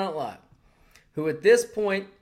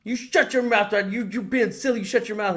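high, louder mock scolding, hammed up; mock angry Joy Behar; 8 semitones higher, 5 dB louder than usual. Joy Behar snapping at Norm, mock angry high scolding. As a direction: scold him like a furious aunt. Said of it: "Joy's like".

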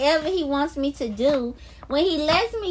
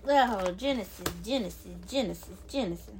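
fading softer with each repeat; awed murmur; 9 semitones lower, 12 dB quieter, faster than usual. Real, not acted. Vlogger savoring Genesis, murmured softly in awe. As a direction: drop to a reverent hush, trailing away.

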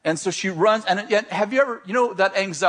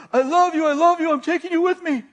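retold yelling; 9 semitones higher than usual. Pursuer yells at fleeing girl as he chases her, high urgent yelling. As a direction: call it out high and forceful. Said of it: "he's yelling something".

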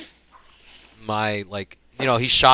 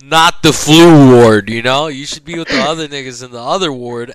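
incredulous yell; 2 semitones higher, 13 dB louder than usual. Retold or imitated. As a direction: hammer home that this isn't his ward. Retelling yelling at hobo.

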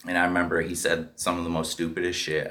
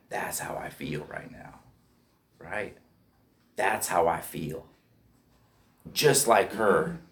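breathy hushed whisper, slow; hopeless whisper; 5 dB quieter, slower than usual. Retold or imitated. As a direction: whisper it to yourself, emptied out. Inner thought, hopeless recognition.

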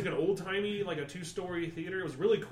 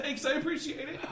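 mock polite; 8 semitones higher than usual. Retold fake polite thanks.

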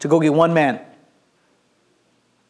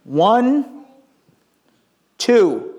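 deliberate counting; 5 semitones higher than usual. Acted. Assistant counts himself and the prophet.

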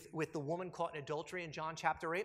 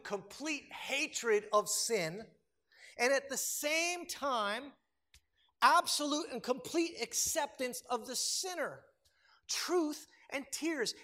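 slow emphasis; 5 semitones higher, 4 dB louder, slower than usual. Real, not acted. Climactic sermon point.

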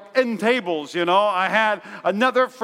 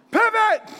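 high mock yell of pivot; 11 semitones higher than usual. Pastor yelling to nobody while moving couch, mock yell. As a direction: bark the word like a sitcom mover. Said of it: "yelling at nobody".

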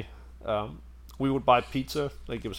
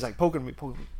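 normal talking voice

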